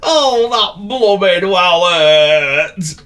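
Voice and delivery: high, loud and very slow moaning complaint; wailing goat. Acted. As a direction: stretch out every word of the hurt. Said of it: "he wailed".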